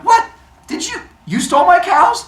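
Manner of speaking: high, loud disbelief; acted indignant astonishment; shocked accusation. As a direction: sputter in outrage at the thief. Apollo discovers his cows were stolen.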